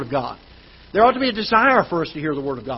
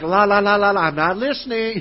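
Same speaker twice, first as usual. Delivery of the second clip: sing-song la-la-la to drown someone out; mock refusal to listen. Dad tuning out his daughter's health lecture, mock stubborn chanting. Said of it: "and I was just like".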